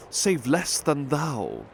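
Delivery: ordinary delivery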